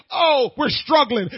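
slow, loud mocking groan; mock the gloomy believer; mock whining. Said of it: "to look like".

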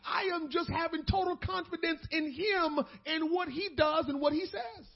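impassioned pulpit climax; full-force preaching. Acted. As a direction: cry it out to the church in full fervour.

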